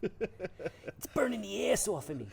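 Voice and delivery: slow, high mock wail; a hint of Jerky Boys whine; Jerky Boys impression. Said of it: "[name]".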